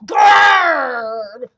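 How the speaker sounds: very loud drawn-out growl; big storybook bear "grrr". A narrator's reading.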